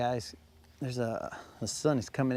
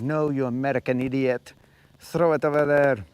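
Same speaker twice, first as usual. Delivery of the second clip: a bad put-on French accent, getting louder; mock Frenchman scolding, hammed-up; lightly done French accent, louder at the end; terrible French accent; 5 dB louder than usual. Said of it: "that was probably a terrible French accent".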